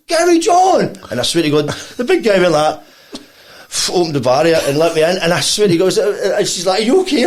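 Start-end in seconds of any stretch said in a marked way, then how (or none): 0.1-1.0 high, loud exclaimed name; excited greeting. Retold or imitated. Singer spots her guest across the foyer, excited shout. 6.8-7.3 excited concern. Retold or imitated. Acting out her concerned greeting.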